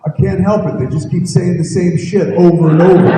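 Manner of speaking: loud, high, laughing excuse; defensive. Retold or imitated. Sleepy actor defends himself to the director, loud and laughing. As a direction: defend yourself, cracking up. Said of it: "He got all defensive".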